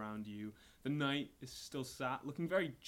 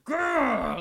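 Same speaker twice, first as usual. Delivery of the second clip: loud, very high angry growl; half grunt, half cry; 14 semitones higher, 16 dB louder than usual. Acted. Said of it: "half grunt, half cry".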